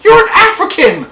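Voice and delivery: high, loud scolding; exasperated shout. Real, not acted. Him yelling at the daughter on screen, high and loud. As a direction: yell at the screen in frustration. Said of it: "scolding the TV character".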